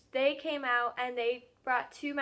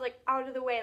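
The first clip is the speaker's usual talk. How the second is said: ordinary talk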